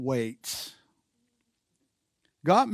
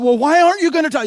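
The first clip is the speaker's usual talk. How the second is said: fast, high, loud exasperated question; frustrated demand; 12 semitones higher, 10 dB louder, much faster than usual. Acted. Frustrated person confronting someone who won't talk, exasperated. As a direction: burst out in acted frustration, pitched high. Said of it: "And we get frustrated".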